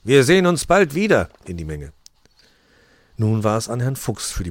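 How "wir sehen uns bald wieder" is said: loud, high farewell call. A narrator's reading. Santa calling farewell to the crowd, loud and high. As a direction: shout it out over the applause.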